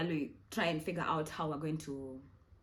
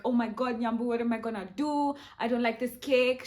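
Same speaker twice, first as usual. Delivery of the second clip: mock hysterical; 4 semitones higher, 5 dB louder than usual. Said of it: "she's, like, so hysterical".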